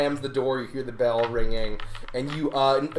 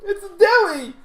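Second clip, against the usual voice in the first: very high, laughing whine; John answering his friend; whiny John voice; 5 dB louder than usual. Acted. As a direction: chirp it like a cheerful loser. GM voicing John.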